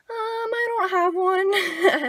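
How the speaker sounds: high, drawn-out mock whimper; nervous confession. Sarah to the cop asking for her license, high mock whimper. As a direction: imitate a scared kid admitting it, high and slow.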